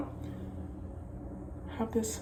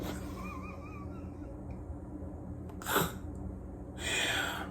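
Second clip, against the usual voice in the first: breathy laugh; 10 dB quieter than usual. Real, not acted. Laughing after pseudonym joke.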